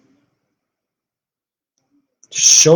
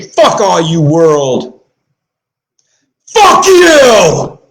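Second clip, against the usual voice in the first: loud and high, exploding on the last fuck you; defiant curse; 4 semitones higher, 6 dB louder, much slower than usual. Pretend. Imagining telling the whole world off, furious yelled curse. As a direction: spit it at the world, peaking at the end.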